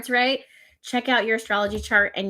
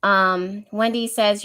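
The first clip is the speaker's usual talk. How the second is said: ordinary talk, nothing marked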